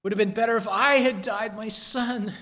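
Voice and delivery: high, keening cry of a father for his son; grieving lament. Acted. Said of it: "Twice we hear his voice".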